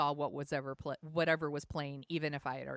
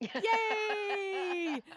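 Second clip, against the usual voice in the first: excited cheer; 4 dB louder than usual. Real, not acted. Celebrating the election result.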